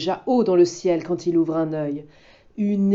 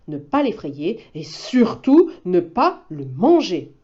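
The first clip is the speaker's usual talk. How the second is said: usual speaking voice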